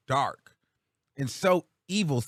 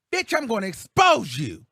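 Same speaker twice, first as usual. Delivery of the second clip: louder, higher snarling threat, hammed up; 1 mock angry threat; 7 semitones higher, 7 dB louder than usual. A vlogger imitating Tasha K's threats, loud mock snarl. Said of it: "Everything is so rooted in".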